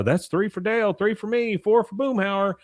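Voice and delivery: high-pitched cartoon tallying voice; Bill. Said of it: "Bill says".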